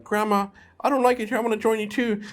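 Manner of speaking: high, lightly voiced little girl pleading to grandma. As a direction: beg grandma in a mild mock child's voice. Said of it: "And then she's like".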